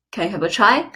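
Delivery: normal talking voice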